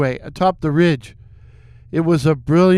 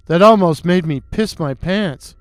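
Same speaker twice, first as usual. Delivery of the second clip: plain reading voice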